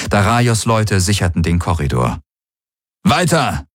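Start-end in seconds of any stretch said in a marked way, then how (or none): 3.0-3.7 high, called-out order; commanding. A narrator's reading. Warlord orders his people down the corridor, commanding. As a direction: urge the troops onward in a raised voice. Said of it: "befahl der Feldherr".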